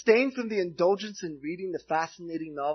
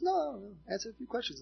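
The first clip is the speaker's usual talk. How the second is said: higher-pitched imitation of gentle insistence; mild pressing; 6 semitones higher, 5 dB quieter, faster than usual. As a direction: lightly press the point, pitched up. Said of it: "she presses a little further".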